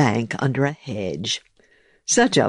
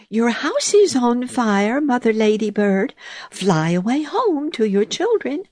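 put-on little old woman voice; 10 semitones higher than usual. Little old woman warns ladybird.

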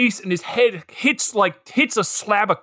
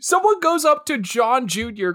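ordinary delivery

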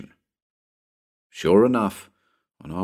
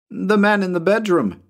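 put-on high-pitched child answering; little boy; 11 semitones higher than usual. Tommy answers Bill from below, high boy voice. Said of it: "returned Tommy".

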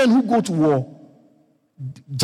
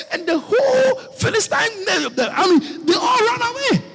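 triumphant preaching shout; 12 semitones higher than usual. Acted. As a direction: proclaim the rout with full force. Climax of Goliath story.

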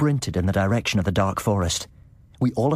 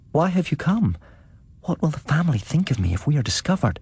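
alarmed woman; 8 semitones higher than usual. A narrator's reading. Sedenka alarmed.

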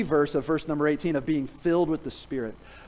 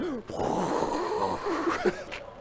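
laugh; 14 semitones higher, 3 dB quieter than usual. Real, not acted. Laughing at own puke story.

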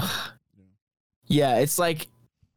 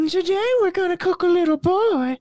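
high, airy cooking-show voice; sweet breathy host announcing the dish; airy cooking lady; 3 dB louder than usual. Retold or imitated. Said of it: "exactly how she sounds, but more Scottish".

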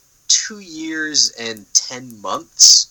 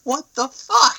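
incredulous outburst; 4 dB louder, slower than usual. Retold or imitated. Retold reaction as dragon flies.

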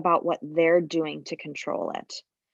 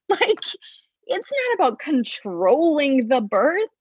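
laughing; 6 semitones higher than usual. Real, not acted. Laughing as she retells it.

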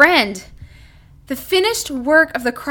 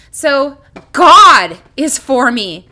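GOD hit louder and held, slow; stress on GOD; 7 dB louder, slower than usual. Shown as a demonstration. Preacher emphasizing each word, slow stress on God. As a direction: lean hard on the word God. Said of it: "emphasize one at a time".

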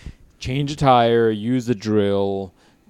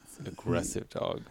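much lower and softer, flat deadpan; 6 semitones lower, 12 dB quieter than usual. Real, not acted. Friend deadpanning the punchline back, low and quiet. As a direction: mutter it dry and low.

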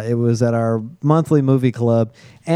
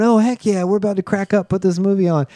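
higher-pitched voice of an eager group; imitated eagerness; 7 semitones higher than usual. Teller imitating friends keen to put the movie on, eager. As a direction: play the friends all keen to start.

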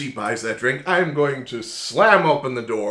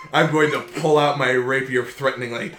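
ordinary talk, nothing marked

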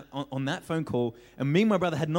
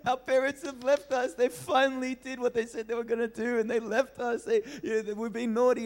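put-on distraught kids; 8 semitones higher than usual. Crying kids at neighbours' door.

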